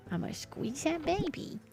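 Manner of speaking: soft, squeezed puppy-talk voice; hushed doting coo; cooing baby talk. Acted. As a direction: gush quietly over a baby animal.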